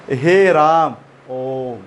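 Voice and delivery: प्लुत स्वर, लंबा खींचकर; prolonged vowels. Shown as a demonstration. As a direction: stretch हे राम like a call to God. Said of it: "ये प्लुत स्वर हो गया".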